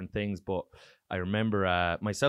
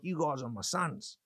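higher, softer mock Aussie; Australian accent; 4 semitones higher, 5 dB quieter than usual. Comic imitating host adopting the visitors, Australian accent. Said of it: "I'm doing this Australian accent".

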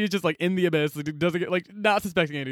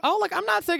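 high-pitched imitated objection; retold protest; 14 semitones higher than usual. Boy objects to being told he's sick, high-pitched retold protest. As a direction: protest innocently, much higher than usual.

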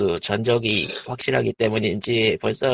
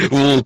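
dry heaving; 3 semitones higher, 7 dB louder than usual. Acted. Mock gagging, louder.

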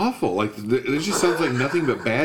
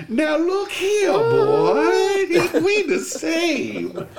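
much higher, slow drawling mock old-timer, half laughing; old biker coach; 13 semitones higher, slower than usual. Off-camera guy feeding lines, hammed-up high drawl. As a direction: ham up a folksy old biker coaxing the actor.

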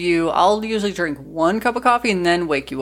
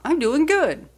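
high, perky "good"; voice going up; 8 semitones higher, slower than usual. Retold or imitated. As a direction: send "doing good" way up in pitch. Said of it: "I just always go up".

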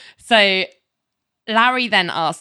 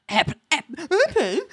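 stuttering start, then a much higher, disbelieving airplane; incredulous stammer; 9 semitones higher than usual. Retold or imitated. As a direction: sputter in mock disbelief. Larry can't believe the answer.

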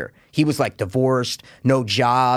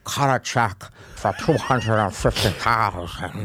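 slow, low mock villain voice with a laugh; lightly voiced sinister movie character; quoting the villain; 3 semitones lower, 3 dB quieter, slower than usual. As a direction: drawl out the order, half laughing. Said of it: "He's like".

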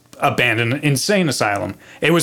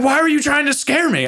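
high-pitched imitation of an upset boy; upset accusation; 14 semitones higher than usual. Frightened friend accuses the boy of scaring him, upset.

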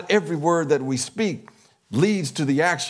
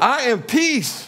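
higher and louder, proclaiming each word; leading affirmation, raised; 7 semitones higher, 3 dB louder, slower than usual. Real, not acted. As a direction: call it out for the room to echo. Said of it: "you can affirm with me".

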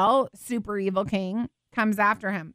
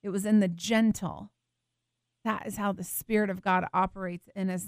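ordinary talk

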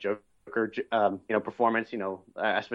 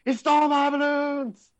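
high, loud, drawn-out movie-villain scream; mock horrified scream; 5 dB louder, slower than usual. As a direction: scream it in hammed-up shock and horror. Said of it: "And then he screams".

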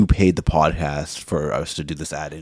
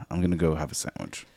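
usual speaking voice

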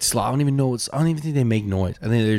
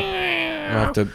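very high cat voice; slower than usual. Pretend. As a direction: squeal it like a hungry cat. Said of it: "my roommate's dog's perking up".

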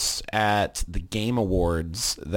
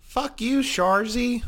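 put-on high shrill northern Canadian accent, yelled; Shoresy Canadian accent; 3 dB louder, much slower than usual. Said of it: "the accent that, uh, Jared Kiso does for when he's playing Shoresy".

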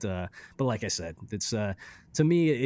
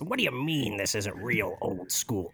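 [name]. Acted. Joining cohost's joke about the hardcore track, [name] impression. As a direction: put on a rock-singer voice and drag the words. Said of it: "thinking about [name]".

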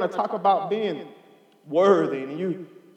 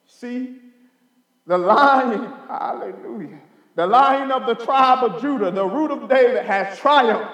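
higher, louder sermon proclamation; preaching at full force; 3 semitones higher, 5 dB louder than usual. Acted. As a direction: thunder the verse to the church. Preaching the elder's words.

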